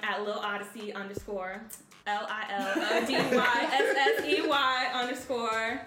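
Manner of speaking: dictating. Real, not acted. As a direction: spell each letter out slowly and clearly. Spelling out her Instagram handle.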